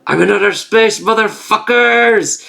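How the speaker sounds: high whoop with stretched last word; mock triumphant cry. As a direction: crow it like a hammed-up space conqueror. Acts out triumphant flier in space.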